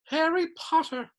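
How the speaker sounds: high squeaky elf greeting his hero; high-pitched elf voice. A narrator's reading. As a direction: squeak out his name in wonder. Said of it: "in a high-pitched voice".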